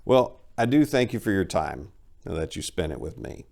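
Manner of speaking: ordinary delivery, nothing marked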